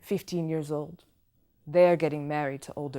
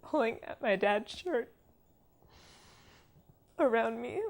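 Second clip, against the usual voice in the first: halting, higher voice with long pauses; distressed; 5 semitones higher than usual. Real, not acted. Woman recalling men surrounding her, halting and distressed. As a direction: struggle to get each phrase out.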